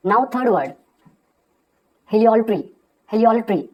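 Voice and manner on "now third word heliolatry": ordinary delivery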